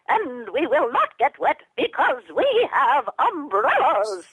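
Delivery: high, slow squeaky puppet voice; tiny high-pitched puppet mom, slow; Twiddlebug mom. Shown as a demonstration. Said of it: "what if she says".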